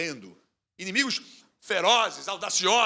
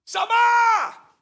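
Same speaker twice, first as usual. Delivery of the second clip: high, loud panicked cry of a name; fleeing crowd shouting for Samar; frantic shout; 13 dB louder than usual. Retold or imitated. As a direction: yell it as people flee. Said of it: "gritando assim".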